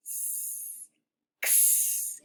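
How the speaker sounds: x as a breathy unvoiced ks hiss; x sound. Shown as a demonstration. Teacher modelling the letter sound for kids, a whispered ks. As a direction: make x as a soft k then s, no voice. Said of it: "like this".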